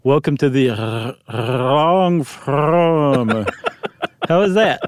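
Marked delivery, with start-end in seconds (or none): 0.0-3.4 "Welcome to the r- long form" with rolling the R and L; rolled L and R. Shown as a demonstration. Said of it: "there's a L and an R in there".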